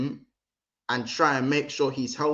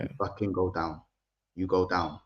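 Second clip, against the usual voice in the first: low, hushed menacing manager; manager's muttered orders, softer and lower; Arteta voice; 6 semitones lower, 6 dB quieter than usual. Retold or imitated. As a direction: growl the order quietly like a scheming coach. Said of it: "You got to do it in the Arteta voice".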